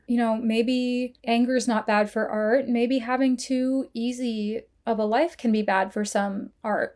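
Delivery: ordinary talk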